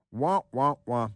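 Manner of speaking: wah wah wah, mock baby crying; hammed-up fake wailing. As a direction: cry like a bawling baby to mock them. Mocking whiners as crybabies.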